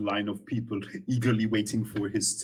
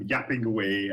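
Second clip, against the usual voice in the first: ordinary talk, nothing marked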